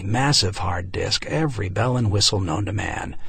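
ordinary delivery, nothing marked

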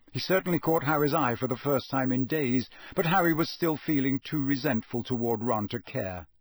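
ordinary delivery, nothing marked

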